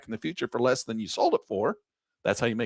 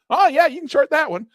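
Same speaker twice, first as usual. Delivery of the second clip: much higher, louder casual okay; breezy broker; 14 semitones higher, 6 dB louder than usual. Acted. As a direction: toss off the yes like it's obvious. Acted trader-broker exchange.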